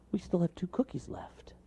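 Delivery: high soft toad whisper; gentle toad whisper. Acted. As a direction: hush it hopefully.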